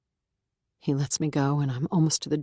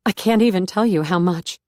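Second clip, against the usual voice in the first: louder, tearful catch in the voice; choked up; 3 semitones higher, 5 dB louder than usual. A narrator's reading. Beth thanking the reverend, tearful and choked. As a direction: push the words through a sob. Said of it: "I choke on a small but audible sob".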